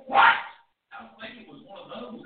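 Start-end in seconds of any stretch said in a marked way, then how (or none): 0.1-0.4 loud, sharp angry What?; angry shout. Shown as a demonstration. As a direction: bark What? at the sleeping disciples.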